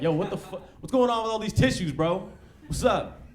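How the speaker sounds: dad calling his son out over the tissues; confronting dad. Retold or imitated.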